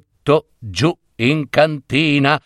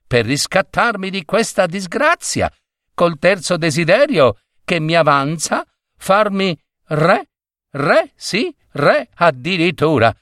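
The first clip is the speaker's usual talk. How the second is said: higher-pitched, scheming self-talk; musing to himself; 6 semitones higher than usual. A narrator's reading. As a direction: warm to a grand idea as you think. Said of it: "diceva Biagio fra sé". (ragiona fra sé, tono più acuto)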